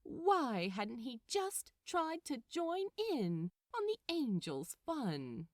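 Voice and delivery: plain reading voice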